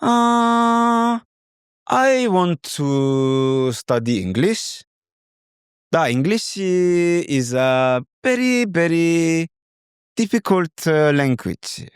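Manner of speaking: put-on high, slow Korean-accented English with long "very"; Korean learner's English. Tutor imitating Korean student's English, high and slow. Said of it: "say something like".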